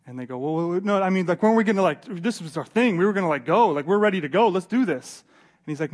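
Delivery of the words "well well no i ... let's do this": lightly acted, pumped-up objection; mild eager protest. Companions protest to Paul about being left behind, eager objection. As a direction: push back, raring to go.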